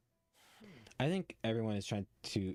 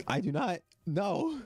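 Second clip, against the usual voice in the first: high, loud protest; loud shocked no; 10 semitones higher, 6 dB louder, slower than usual. Real, not acted. Streamer to chat, shouting no in shock. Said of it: "streamer's shocked disclaimer".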